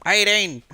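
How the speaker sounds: "Eighteen" mimicked high and loud; mimicking accent. Chat repeating his Aussie number back, high and loud. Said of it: "They're like".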